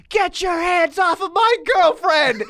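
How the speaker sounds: high, drawn-out heckler's shout; yelling fan. Retold or imitated. Imitating a fan screaming during a lull in the match, yelling. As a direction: bellow it slowly like an outraged fan. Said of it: "he just starts yelling".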